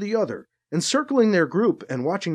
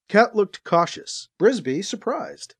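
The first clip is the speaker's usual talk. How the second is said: usual speaking voice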